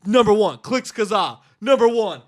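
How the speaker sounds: excited. Retold or imitated. Listing search results, getting louder.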